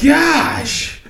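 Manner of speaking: high, loud outburst; exasperated shout. Real, not acted. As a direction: exclaim loudly at being teased. Mock exasperation after being teased.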